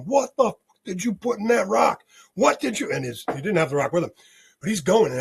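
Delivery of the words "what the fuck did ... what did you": retold yell. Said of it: "and he's yelling".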